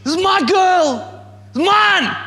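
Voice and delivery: high, swelling cry of pride; the Father proudly claiming his child; proud heavenly father. Pretend. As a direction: claim them as his own, beaming. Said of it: "looks at you and says".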